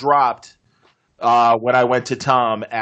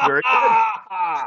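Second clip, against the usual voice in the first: drawn-out praise; 8 semitones higher, much slower than usual. Pretend. Host praising an answer.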